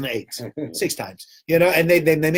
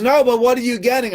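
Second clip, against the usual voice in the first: insistent lawyer; 11 semitones higher, 5 dB louder than usual. Retold or imitated. Lawyer presses the rabbi.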